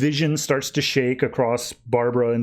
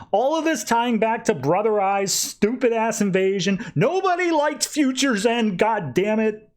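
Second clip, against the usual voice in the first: exasperated rant; 8 semitones higher than usual. Retold or imitated. Tim's rant at the villain.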